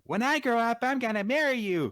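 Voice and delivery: put-on high, loud childlike voice; boy's promise to big sister, childish; little kid voice. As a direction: squeak it like a small child. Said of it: "a little kid saying".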